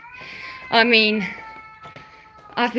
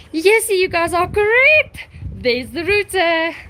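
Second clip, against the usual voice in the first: excited find; 6 semitones higher, 4 dB louder than usual. Real, not acted. Finding the router, viewers right.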